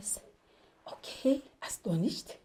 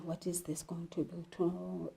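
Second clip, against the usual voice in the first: much softer, muttered to himself; low, soft aside; 4 semitones lower, 16 dB quieter than usual. Acted. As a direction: say it as a quiet aside. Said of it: "doing the asides".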